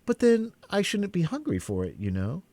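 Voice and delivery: put-on high girlish voice, thoughtful; musing it over as a young girl; lightly voiced thoughtful girl; thoughtful Alice. A narrator's reading. Alice's voice in story.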